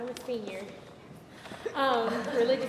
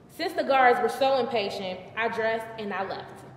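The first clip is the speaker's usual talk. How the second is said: ordinary delivery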